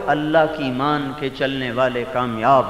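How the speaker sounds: usual speaking voice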